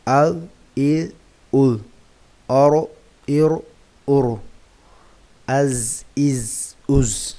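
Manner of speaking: crisp, emphatic teacher recitation of each syllable; syllable drill. Shown as a demonstration. Teacher reciting jazm syllables for children, crisp and clear.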